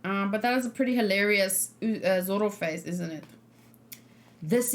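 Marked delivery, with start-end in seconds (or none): none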